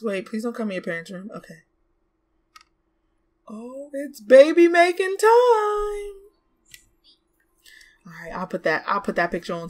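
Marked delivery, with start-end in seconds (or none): 3.5-6.3 slow, higher teasing announce; giddy sing-song; sing-song excitement. Real, not acted. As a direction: drawl it out playfully.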